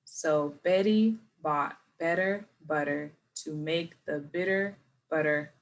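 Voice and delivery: slow, careful tongue twister; slow model read; slow first try. Shown as a demonstration. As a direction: go word by word slowly. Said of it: "we do wanna start off slow".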